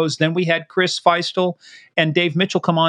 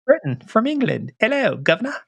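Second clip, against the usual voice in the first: mock British greeting, higher pitched; British accent; 6 semitones higher than usual. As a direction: put on a hammy British accent. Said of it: "This is in a proper British accent".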